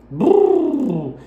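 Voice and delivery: high, loud "brrr" lip buzz; baby lip trill. Shown as a demonstration. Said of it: "Esses sons de brrr".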